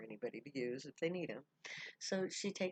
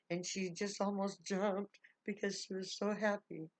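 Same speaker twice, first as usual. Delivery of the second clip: higher, louder, bubbling with joy; excited narration; 6 semitones higher, 4 dB louder than usual. A narrator's reading. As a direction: tell it like you can barely contain the joy.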